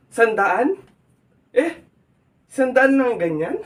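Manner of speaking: high, rising disbelief; astonished, pitch shooting up at the end; incredulous. Acted. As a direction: echo the price as a loud, incredulous question. Shocked at a low price.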